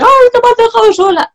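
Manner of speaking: hammed-up voice of a daughter left out by her friends; imitating crying daughter. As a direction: squeak it out like a tearful little girl. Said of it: "y me viene llorando a veces".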